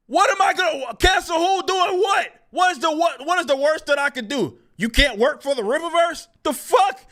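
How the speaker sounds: high incredulous shouting; exasperated shout. Retold or imitated. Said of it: "And I'm like".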